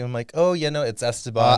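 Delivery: usual speaking voice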